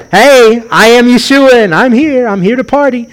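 high, loud party-arrival announcement; breezy mock Yeshua. Preacher parodying what Yeshua is not saying, mock breezy. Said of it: "It's not like he's saying".

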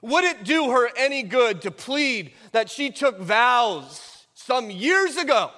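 fiery sermon voice, hammering each phrase; preaching at full force. Acted. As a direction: preach it like a warning from the pulpit.

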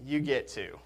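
loud, slow fatherly correction; dad's stock reply. Retold or imitated. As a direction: lay it down like a stern dad. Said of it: "he would say".

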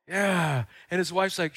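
ordinary delivery

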